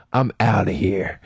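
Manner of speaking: low, slow, offhand exit line; dopey clerk checking out; dismissive clerk. Retold or imitated. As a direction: toss it off low and drawn out. Clerk walking away, mocked.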